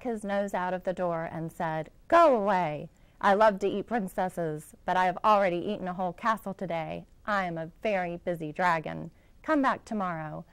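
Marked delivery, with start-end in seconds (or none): 2.1-10.4 loud, brisk dragon brushing her off; a self-important dragon in a hurry; busy dragon. Acted. Dragon dismissing the princess at his door, loud and hurried.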